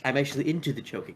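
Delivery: fast joking aside. Acted. Mock flirt joke.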